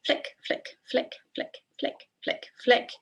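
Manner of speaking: short, light, evenly repeated flicks; quick rhythmic cue. Shown as a demonstration. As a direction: tap out a brisk rhythm for the movement. Counting out quick foot flicks.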